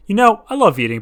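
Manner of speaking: lightly voiced Angus. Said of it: "Angus said".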